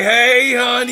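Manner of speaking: sing-song it out like she's calling across the room; imitating big girl. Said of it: "big girl say".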